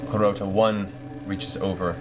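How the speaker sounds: ordinary talk, nothing marked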